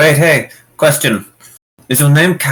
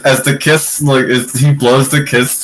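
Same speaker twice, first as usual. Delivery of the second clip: ordinary delivery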